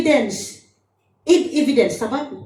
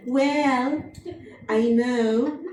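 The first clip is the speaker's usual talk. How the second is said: soft, lower put-on sweet voice; imitating sweet woman; 4 semitones lower, 7 dB quieter, much slower than usual. Preacher mimics a sweet woman she listened to, put-on sweetness. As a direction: mimic a very sweet lady, softly. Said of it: "isang babae na napaka-sweet, I am trying to imitate".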